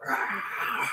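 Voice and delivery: breathy, voiceless "rah" snarl; imitated dog growl. Storyteller showing how the stray dog charged, mock snarl. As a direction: imitate a ferocious dog, softly. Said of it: "It was like this".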